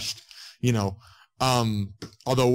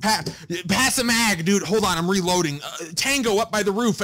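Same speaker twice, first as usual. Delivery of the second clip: fake gamer banter; 7 semitones higher than usual. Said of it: "you hear the people in game".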